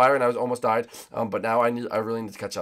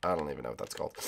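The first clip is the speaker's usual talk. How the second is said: normal talking voice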